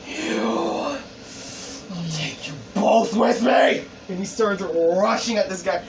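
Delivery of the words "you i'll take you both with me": drawn-out dramatic threat in a put-on voice; killer's battle threat. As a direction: build from a strained word to a battle cry. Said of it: "he says these words".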